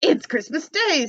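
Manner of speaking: slow, amazed self-talk; Scrooge marveling. Acted. Scrooge telling himself in slow delight. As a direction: savor the discovery. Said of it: "said Scrooge to himself".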